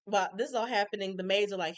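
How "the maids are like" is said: ordinary delivery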